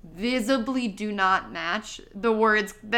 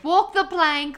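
mock Cockney; 8 semitones higher, 3 dB louder, slower than usual. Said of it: "a Cockney accent".

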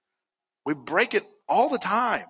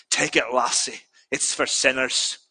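put-on lightly done Scottish accent, low and slowing; a hint of a kindly Scottish pastor's accent; 5 semitones lower than usual. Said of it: "in, like, a good Scottish accent".

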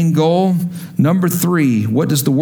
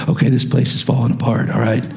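lowered, confidential team talk; acted insider confession, pitched down; private staff-meeting admission; 4 semitones lower than usual. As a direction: drop the front and admit trouble behind closed doors. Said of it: "on the inside in staff meeting".

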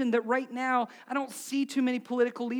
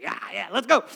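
louder, mock eager cheer; mock riled-up; 8 dB louder than usual. Social media poster stirring up angry comments, mock riled-up. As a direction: imitate someone egging on an angry crowd. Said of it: "Everybody's gonna be angry".

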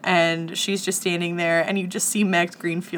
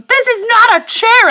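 high, loud outburst of indignation; furious shout; 11 dB louder than usual. Retold or imitated. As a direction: yell it while hurling the ball. Doris throws ball, shouting.

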